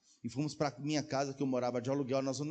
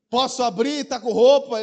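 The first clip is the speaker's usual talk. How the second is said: calling through a door; 10 semitones higher, 13 dB louder than usual. Retold or imitated.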